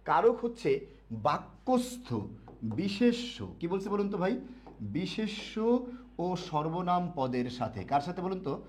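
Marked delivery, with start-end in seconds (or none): 0.1-2.1 slow, word by word for students to write; slow dictation. Shown as a demonstration. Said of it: "একটু লিখবেন সবাই". 4.8-7.8 slow, word by word for students to write; slow dictation. Shown as a demonstration. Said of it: "একটু লিখবেন সবাই".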